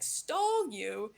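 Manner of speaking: usual speaking voice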